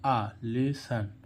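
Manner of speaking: आ drawn out long and slow before lesson; slow teaching demo of आ in lesson; stretched demo. Shown as a demonstration. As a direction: hold the आ then say lesson slowly. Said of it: "इस ओएन का उच्चारण क्या निकल रहा है".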